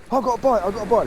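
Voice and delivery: imitating an excited mate, high-pitched; high, noisy novice angler impression; loud-mouthed mate. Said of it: "he's very loud-mouthed and, and noisy".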